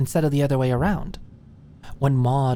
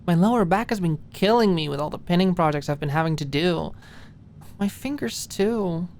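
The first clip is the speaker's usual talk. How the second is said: higher voice for Maud, tired and complaining; a tired young woman griping about travel; Maud's higher voice, achy and complaining; Maud, weary; 5 semitones higher than usual. Acted. Maud's voice in the story.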